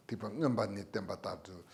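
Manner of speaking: ordinary talk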